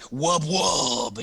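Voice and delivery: long drawn-out mock sound effect; imitated wub wub. Player imitating the helmet's power, drawn-out wub wub. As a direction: stretch out each wub like a silly effect. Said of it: "So it's like, it's like".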